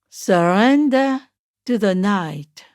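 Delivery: usual speaking voice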